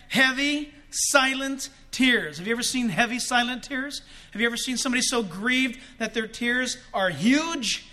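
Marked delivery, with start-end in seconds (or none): 0.1-2.4 slowed. Shown as a demonstration. Slowed description of silent tears.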